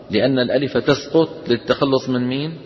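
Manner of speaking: normal talking voice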